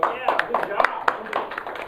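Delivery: mild clap praise. Shown as a demonstration. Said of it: "so you go".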